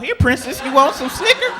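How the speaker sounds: lightly voiced baby talk to a dog; coaxing offer. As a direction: tempt the dog with a treat.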